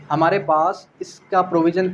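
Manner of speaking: ordinary talk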